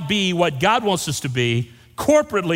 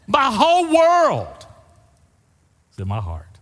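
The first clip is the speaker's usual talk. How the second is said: emphatic refrain; 9 semitones higher, 4 dB louder than usual. Real, not acted.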